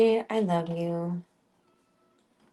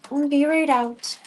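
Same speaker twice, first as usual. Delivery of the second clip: higher, louder, slow call out; 6 semitones higher, 7 dB louder than usual. Real, not acted. Owner calling to the dogs outside, louder and slow.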